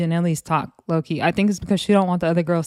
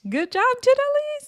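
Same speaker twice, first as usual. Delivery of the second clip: cheering. Real, not acted. Cheering Janelle after the fight.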